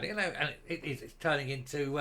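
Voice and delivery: ordinary talk, nothing marked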